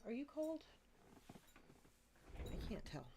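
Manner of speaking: normal talking voice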